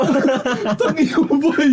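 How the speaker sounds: high-pitched words through laughter; laughing. Real, not acted. Friends joking that the stitches look like welding, laughing. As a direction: crack up while teasing a friend.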